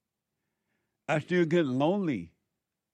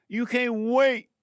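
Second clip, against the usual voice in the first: high, loud, drawn-out rebuke; preaching shout; 10 semitones higher, 5 dB louder, slower than usual. Real, not acted. Preacher to congregation about their anger, slow loud shout. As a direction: cry it out slowly from the pulpit.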